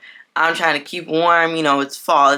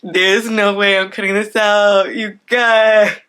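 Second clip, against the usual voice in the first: laughing through tears; 3 semitones higher, 6 dB louder, slower than usual. Real, not acted. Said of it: "Give me a second".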